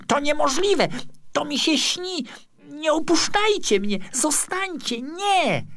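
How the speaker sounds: high-pitched, panicked pleading; desperate screaming. A narrator's reading. Sculptor pleads with departing statues, desperate high cries. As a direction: cry out and beg them to stay. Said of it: "wrzasnął nieszczęśliwy rzeźbiarz".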